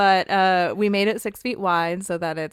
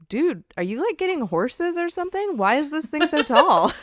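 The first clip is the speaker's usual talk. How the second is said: higher, amused voice quoting a buddy; quoting a friend; 10 semitones higher, 5 dB louder than usual. Retold or imitated. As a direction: pitch it up, laughing at the end. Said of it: "Nate's like".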